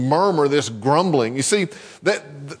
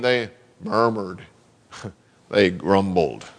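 grumbling; 10 semitones lower than usual. Shown as a demonstration. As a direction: growl it out like a grumbling crowd. Preacher mimics grumbling voices.

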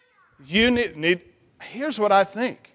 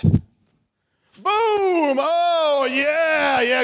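excited cheer; 7 semitones higher, much slower than usual. Real, not acted. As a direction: cheer the shot like a big win. Celebrating the boy's trash can shot.

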